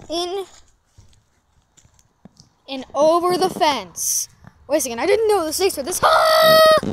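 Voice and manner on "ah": high loud startled scream. Acted. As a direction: shriek in shock. Caught by surprise stakes.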